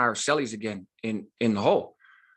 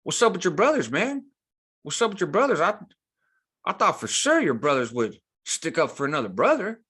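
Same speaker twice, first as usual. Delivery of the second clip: high, jeering guard's taunt; mocking taunt; 6 semitones higher than usual. Guards taunting a prisoner at his cell door, higher mocking jeer. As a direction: needle him like a smug guard would. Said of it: "The seals are clowning me".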